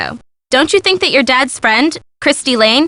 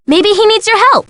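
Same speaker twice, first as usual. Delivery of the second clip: higher-pitched, insistent urging; urgent prodding; 5 semitones higher than usual. Acted. Friend urges the sleuth to go help him, urgent prodding. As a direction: push your friend to get moving.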